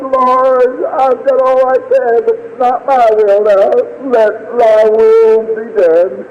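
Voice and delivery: high, slow, grieving prayer; anguished surrender. Retold or imitated. Father yielding his child to God, anguished surrender. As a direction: give up the child in sorrow.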